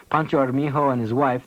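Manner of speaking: usual speaking voice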